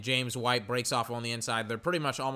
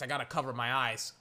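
ordinary talk

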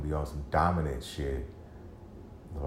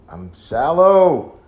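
a slow, loud, high cry of self-reproach; frustrated outburst; 10 semitones higher, 13 dB louder, much slower than usual. Real, not acted. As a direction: drag it out, loud and pained. Outburst about himself.

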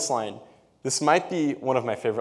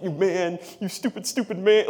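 higher mock insult, lightly voiced; Rufio; 7 semitones higher than usual. Rufio losing the argument to Peter Pan, mock high insult. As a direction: imitate a boy with no comeback, lightly. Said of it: "Rufio's like".

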